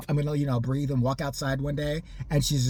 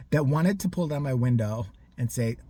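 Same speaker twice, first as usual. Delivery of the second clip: ordinary talk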